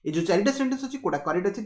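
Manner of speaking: ordinary delivery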